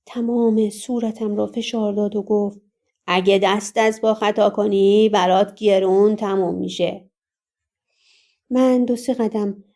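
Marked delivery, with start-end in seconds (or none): 3.1-7.1 thick threatening voice. A narrator's reading. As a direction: threaten her with a thick, heavy voice. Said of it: "با همان صدای کلفتش".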